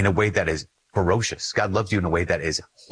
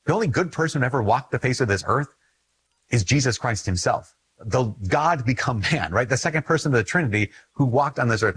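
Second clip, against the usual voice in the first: ordinary talk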